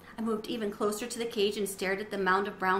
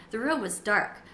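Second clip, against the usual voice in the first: ordinary delivery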